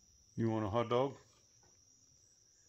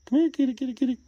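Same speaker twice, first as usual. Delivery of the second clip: loud high sing-song call; high cat call; 13 semitones higher, 6 dB louder, much faster than usual. Real, not acted. Owner calling the cat in loud and high.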